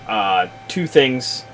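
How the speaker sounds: ordinary talk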